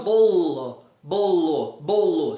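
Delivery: "bolo" braking on a long L before the O; interrupted sound. Shown as a demonstration. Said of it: "É um som que você interrompe". (bolo freado no L antes do O)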